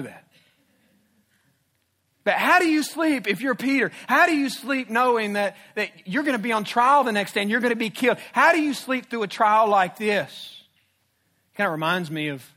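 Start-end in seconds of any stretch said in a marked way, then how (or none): 2.2-10.6 higher, louder, hammering each how; emphatic rhetorical preaching. Real, not acted. Preacher pressing the congregation with questions, loud and emphatic.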